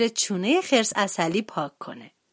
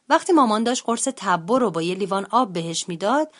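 ordinary delivery

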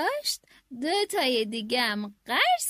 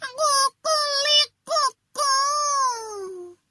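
long, drawn-out crow, higher and louder; rooster crowing; 9 semitones higher, 4 dB louder, much slower than usual. Acted. Rooster calling his friends, a long loud crow. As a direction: crow out proudly with chest puffed.

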